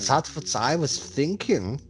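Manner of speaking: high, slow hammed-up German accent. Host jokes after calling a character Germanish, mock German accent. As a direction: put on a comic German accent. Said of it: "That was [name]'s best German accent".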